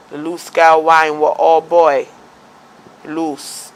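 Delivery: slow, drawn-out Jamaican patois; Jamaican accent. Retold or imitated. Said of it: "keep your Jamaican accent to yourself".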